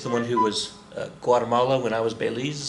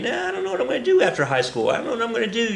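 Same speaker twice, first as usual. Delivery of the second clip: put-on higher, faster aimless teen voice; unsure student; 9 semitones higher, faster than usual. Teacher voicing a student unsure after high school, higher and quick. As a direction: toss it off like a shrugging teenager.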